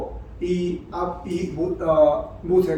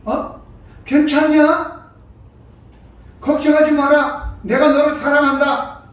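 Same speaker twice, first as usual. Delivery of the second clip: reassuring parent; 6 semitones higher, 6 dB louder than usual. Retold or imitated. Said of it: "미국 사람들은".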